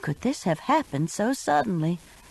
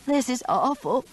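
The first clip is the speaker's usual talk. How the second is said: sobbing; 6 semitones higher than usual. A narrator's reading. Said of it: "Mitzi sobbed".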